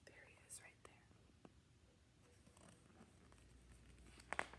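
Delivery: hushed whisper. A narrator's reading. Narrator pointing out hiding Scaredy, in a hushed whisper. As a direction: whisper it so he isn't spotted.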